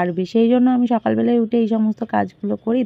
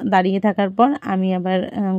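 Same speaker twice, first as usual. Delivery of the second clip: usual speaking voice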